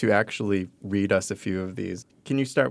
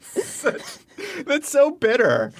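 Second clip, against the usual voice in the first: laughing; 10 semitones higher than usual. Real, not acted. Laughing at bitter text.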